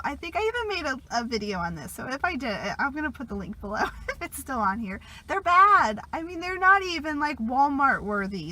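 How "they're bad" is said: "bad" hit loud and high, falling; loud blunt verdict on "bad"; emphatic bad. Real, not acted. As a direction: lean hard on "bad". Repeats that cheap bras are bad.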